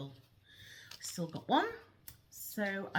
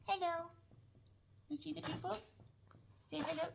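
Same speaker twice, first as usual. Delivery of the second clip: dog's voice; 12 semitones higher, 6 dB quieter, faster than usual. Pretend. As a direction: put on the dog's tiny high voice to greet viewers. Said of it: "She speaks like this".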